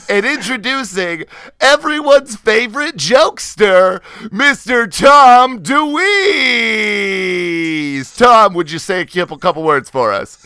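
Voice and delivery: high, loud emcee build to a long-held name; hammed-up announcer. Host introducing everyone's favorite jokester, hammed-up announcer. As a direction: belt out the name like a ring announcer. Host's show-opening announcer act.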